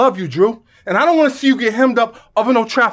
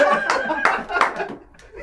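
burst of laughter; 6 semitones higher than usual. Acted. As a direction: crack up laughing. Laughing at bad dancing.

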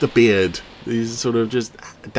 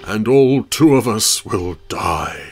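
slow, louder, hammed-up tragic voice; mock doom; 5 dB louder, slower than usual. Commentator mocking the alien city's fate, slow hammed-up despair. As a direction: ham up the doom to mock the tiny city.

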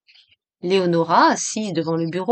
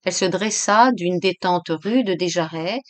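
ordinary delivery

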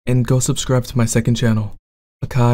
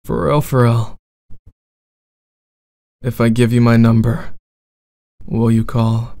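bad boy roleplay.

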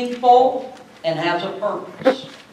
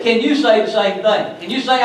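normal talking voice

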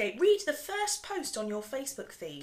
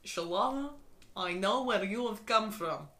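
put-on low, softer Russian accent; made-up Russian-accented line; 6 semitones lower, 5 dB quieter than usual. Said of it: "I'm just gonna say Russian".

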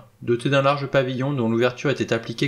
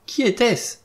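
much higher, alarmed cry; insistent cry; 12 semitones higher, slower than usual. A narrator's reading. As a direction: repeat the question in a high, frantic pitch. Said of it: "s'écria encore Vera".

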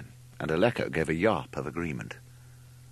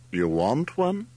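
higher, rising question of surprise; surprised; 5 semitones higher, 4 dB louder, slower than usual. A narrator's reading. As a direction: ask it taken by surprise, pitch up. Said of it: "he asked in surprise".

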